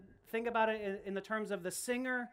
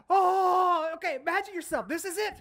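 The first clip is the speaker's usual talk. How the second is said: mock panic; 8 semitones higher, 7 dB louder than usual. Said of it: "It was like".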